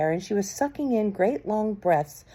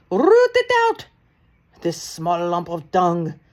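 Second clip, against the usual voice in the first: much higher, loud screeching order; 6 dB louder, slower than usual. A narrator's reading. Grand High Witch screeches orders at the witches. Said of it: "screeched the Grand High Witch".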